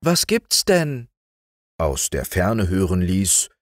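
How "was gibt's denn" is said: put-on high, sing-out girlish question; drawn-out, high cheerful call; cheery call. A narrator's reading. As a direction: call it merrily from afar. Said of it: "mit einem lustigen".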